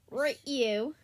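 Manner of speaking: put-on quiet, tentative Australian accent; lightly put-on Australian accent; attempted Australian accent. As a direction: try out an Australian accent softly. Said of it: "I'm getting an Australian accent".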